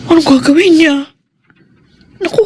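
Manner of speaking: sharp gasp, then a high worried question; suddenly frightened by a thought; alarmed gasp. Acted. Wife fearing her husband will cheat, gasping in alarm.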